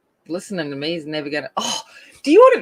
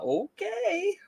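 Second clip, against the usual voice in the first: high, soft, drawn-out doubt; dubious; 6 dB quieter than usual. Retold or imitated. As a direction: let it float up sceptically. Retold sceptical reaction to survey.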